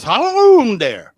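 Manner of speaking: high, loud hammed-up Cajun wail; mock Cajun howl. Friend showing how a Louisiana howl goes, loud mock Cajun wail. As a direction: belt it out like a swamp-monster howl in Cajun. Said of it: "Uh-uh, it goes like this".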